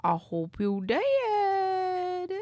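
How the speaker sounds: did drawn out high and slow; high sing-song. Pretend. Streamer teasing a chatter, in a high, slow sing-song. Repeated line, second take higher.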